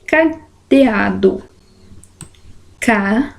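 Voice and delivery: "cadeado" split slowly into ca-de-a-do; sílaba por sílaba. Shown as a demonstration. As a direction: sound out "cadeado" in separate syllables, pausing after each. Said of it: "quatro sílabas". ("cadeado" separado em sílabas, com pausas)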